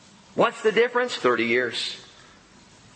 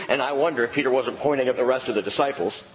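ordinary talk

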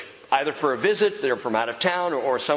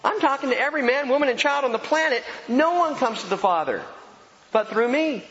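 full-force preaching; 9 semitones higher than usual. Acted. Pastor preaching to the congregation, high and forceful. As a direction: preach it out to the whole room.

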